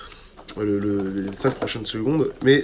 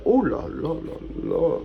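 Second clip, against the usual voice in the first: awed; 2 semitones higher, 4 dB quieter, slower than usual. Real, not acted. Admiring the island map.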